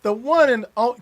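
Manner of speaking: a touch of a hyped show host; mock announcer. Host mock-introduces guest like a showman. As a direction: announce her like a star, half joking.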